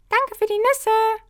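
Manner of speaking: very high, loud goblin call; shouted goblin thanks. A narrator's reading. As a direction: call it out while leaving. Kobold ruft zum Abschied. (sehr hoher, lauter Koboldruf)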